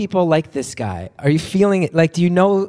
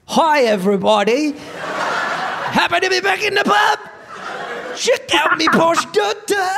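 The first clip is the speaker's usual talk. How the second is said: Cockney accent; 12 semitones higher, slower than usual. Shown as a demonstration. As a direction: holler a badly faked Cockney pub greeting. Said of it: "what that must sound like in a Cockney accent".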